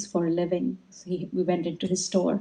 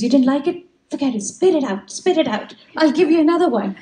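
high, eager imitation of an insistent host; urgent fussing; 7 semitones higher, 5 dB louder than usual. Sweet-maker to the guest who disliked a taste, urgently fussing. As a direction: fuss over the guest, pitched well up.

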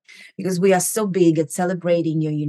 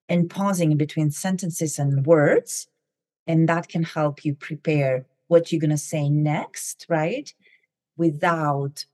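ordinary delivery

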